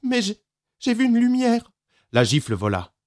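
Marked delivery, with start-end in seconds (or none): 0.0-1.7 put-on higher, stammering stubborn child; small boy pleading stubbornly; insisting child. A narrator's reading. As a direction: insist again despite the threat. Boy insists despite father.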